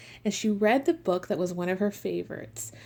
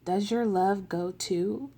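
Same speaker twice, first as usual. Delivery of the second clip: slow, gentle storybook read; picture-book reading with voiced characters; reading to children. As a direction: read it like a bedtime book for little kids.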